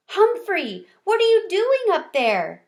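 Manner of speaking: high, loud startled call; alarmed surprise. Acted. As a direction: shout up in alarm at the dangling hamster. Gigi sees hamster hanging.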